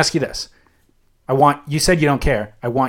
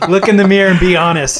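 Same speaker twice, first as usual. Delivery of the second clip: laughing; 5 semitones higher, 5 dB louder than usual. Real, not acted. Laughing through a challenge.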